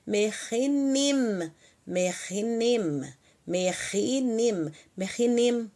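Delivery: "merinim" bem lento, com os dois Is claros; both i's heard. Shown as a demonstration. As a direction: arraste "merinim" marcando cada sílaba. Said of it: "Tem que fazer esse som do, os dois Is". (repetição lenta de "merinim" para a turma)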